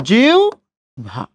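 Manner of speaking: জিউভা stretched very slowly, each sound held; slow drawn-out pronunciation. Shown as a demonstration. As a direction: drag জিউভা out syllable by syllable. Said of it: "হস দিয়েও লিখতে পারেন". (খুব ধীরে টেনে জিউভা)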